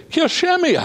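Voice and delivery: sudden high, cold command; ruthless order. Retold or imitated. Dying David orders Solomon, high stern command. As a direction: bark the dying king's order. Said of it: "And then he says".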